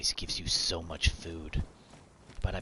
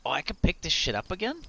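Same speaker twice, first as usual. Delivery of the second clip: sudden high, loud surprise; surprised; 11 semitones higher, 5 dB louder, faster than usual. Real, not acted. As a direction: caught off guard, voice jumps up. Surprised he can grab it again.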